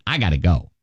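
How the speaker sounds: usual speaking voice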